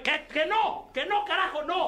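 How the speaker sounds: high, curt mock refusal as the bird; gruff bird's refusal. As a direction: snap a hammed-up no at the monkey. Bird refuses monkey in joke.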